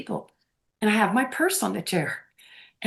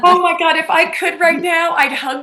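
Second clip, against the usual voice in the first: gushing, higher and louder, with a laugh; excited gush; 6 semitones higher, 4 dB louder than usual. Real, not acted. Guest thanking host who let her say it, excited gush. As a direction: burst out laughing with delight. Delighted reply to host's question.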